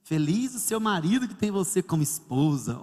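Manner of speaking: low, slow smooth-talker impression; flattering male coworker. Male coworker flatters a married woman, imitated low slow flattery. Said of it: "O rapaz do meu trabalho, quando eu chegava, ele dizia".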